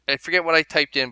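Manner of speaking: normal talking voice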